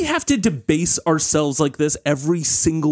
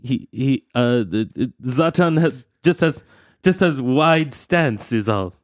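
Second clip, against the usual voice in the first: put-on Swedish accent; 2 semitones lower, slower than usual. Zlatan flustered.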